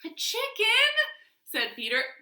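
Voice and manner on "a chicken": high disbelieving question; can't believe it, a chicken; incredulous squeak. Acted. Peter incredulous.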